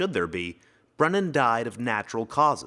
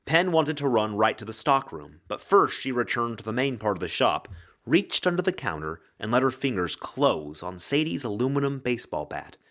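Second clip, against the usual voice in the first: plain reading voice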